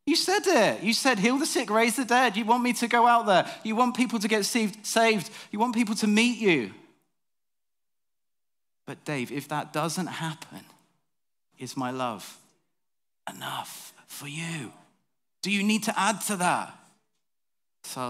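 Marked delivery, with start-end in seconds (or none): 0.0-6.7 much higher and louder, urgent pleading; insistent protest. Retold or imitated. Dave pleads with God to send him out. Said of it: "And I'm like". 8.8-16.8 tender voice of God. Acted. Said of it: "God's reply".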